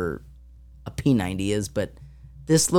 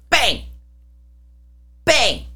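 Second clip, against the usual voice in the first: high sharp bang like a pistol shot; gunshot imitation; 9 semitones higher than usual. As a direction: bark out bang like gunfire.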